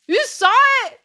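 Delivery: high, loud squeal of disbelief; mock shock. Real, not acted. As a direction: yelp it out, caught red-handed. Shocked at being seen.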